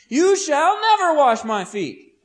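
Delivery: slow, high, loud declaration; strong refusal. Acted. Peter forbids Jesus to wash his feet, strong. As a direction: refuse adamantly, stretching it out. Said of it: "if that sounds strong".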